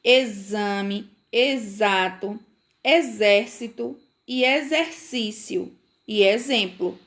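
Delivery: "Exame, exato, exército" listed with a clear z; each x in "Exame, exato, exército, exercício, exemplo" buzzed as z; X with Z sound. Shown as a demonstration. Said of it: "tem o som de".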